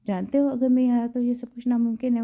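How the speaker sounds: ordinary delivery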